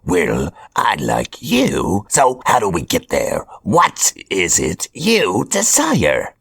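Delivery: Hobgoblin voice. Acted. Hobgoblin announces his chosen apprentices, smug villain voice. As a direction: play a smug supervillain handing out orders.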